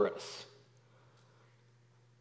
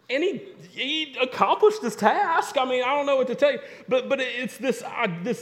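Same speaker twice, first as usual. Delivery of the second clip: laughing; 8 semitones higher than usual. Real, not acted. Preacher laughing at the punchline.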